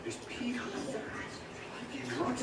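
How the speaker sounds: hushed whisper. Acted. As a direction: whisper it, wondering. Eyes closed, hearing a crowd.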